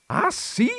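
high-pitched indignant challenge; indignant cry. A narrator's reading. Countess crying out at the visitor, indignant. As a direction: throw it back in outrage. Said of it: "gridò".

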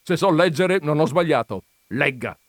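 loud, firm command; insist, brooking no reply; peremptory. A narrator's reading. Visitor making the countess read her article, peremptory. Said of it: "con un tono che non ammetteva repliche".

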